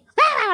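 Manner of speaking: sudden high, loud mock scream; imitated scream. Church speaker mimics a grandma suddenly screaming, mock scream. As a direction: burst into a grandma's shriek. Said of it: "suddenly, like, screaming".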